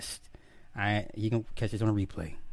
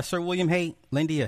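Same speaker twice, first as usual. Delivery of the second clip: ordinary talk, nothing marked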